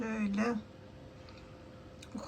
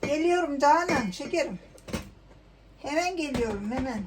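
raised voice calling to a friend; 4 semitones higher, 4 dB louder than usual. Real, not acted. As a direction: call back that you're coming. Calling out to Canan.

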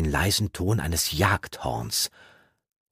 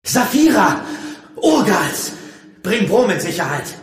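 high, loud alarm shout; full-force cry for help; roaring; 13 semitones higher, 8 dB louder than usual. A narrator's reading. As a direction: bellow an urgent warning. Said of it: "brüllte in Gedanken".

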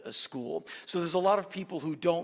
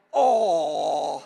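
mock touched aww; 8 dB louder than usual. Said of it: "I'm like".

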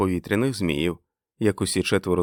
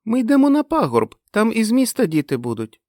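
put-on high little-girl shout; high-pitched childish calling; child's excited cry; 5 dB louder than usual. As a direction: call it out like an eager child. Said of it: "кричала Мег".

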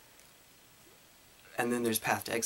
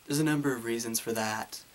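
ordinary delivery

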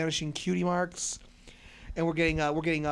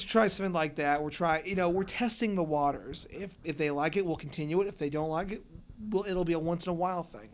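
ordinary talk

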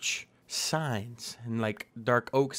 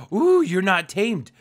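excited; 8 semitones higher, 9 dB louder, slower than usual. Real, not acted. Taming horse, excited squeal.

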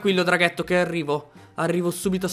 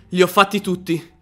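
normal talking voice

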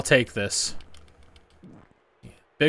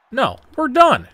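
much higher and louder, outraged; furious yell; 13 semitones higher, 7 dB louder than usual. Real, not acted. Gamer yelling at opponent Kane, furious. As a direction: scream it in fury.

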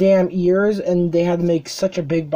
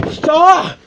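real frustrated shout; 9 semitones higher, 5 dB louder than usual. Real, not acted. Box lid won't stay shut.

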